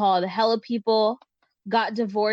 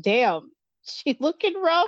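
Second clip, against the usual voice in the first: mock call-out, drawn out and higher; imagined yell; 6 semitones higher, slower than usual. Someone spots you on the video chat, mock yell. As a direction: call it out slowly like a teasing onlooker. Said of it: "someone just yells out".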